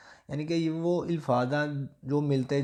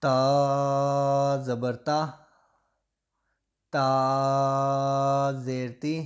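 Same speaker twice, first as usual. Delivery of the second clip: very slow, louder sing-song drill; spelling drill; 4 dB louder, much slower than usual. Shown as a demonstration. Teacher leading a child's spelling chant, very slow and loud. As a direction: drag each syllable out for the class to repeat. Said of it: "میں آپ کو یہ جوڑوں کے ساتھ ہی پڑھاؤں گا".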